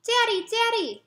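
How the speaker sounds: put-on high, loud urgent child call; a scared boy waking his dad; child waking father. Jeremy waking his sleeping dad, high urgent child's call. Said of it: "whispers Jeremy".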